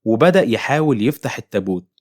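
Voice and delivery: plain reading voice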